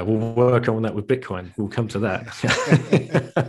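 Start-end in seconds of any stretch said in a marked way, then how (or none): 2.0-3.5 slow, laughing through the words; amused laugh over the joke. Real, not acted. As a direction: chuckle it out.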